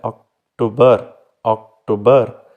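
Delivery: October split after ok, weight on "tober"; second syllable stressed. Shown as a demonstration. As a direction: pause inside October, then lean on the second part. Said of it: "जो दूसरा है". (अक्टूबर को तोड़कर दूसरे हिस्से पर ज़ोर)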